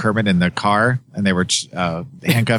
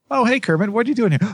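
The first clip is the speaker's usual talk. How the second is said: high squeaky Muppet greeting; 14 semitones higher, faster than usual. Retold or imitated. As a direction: pipe it up like a puppet. Quoting Muppet characters.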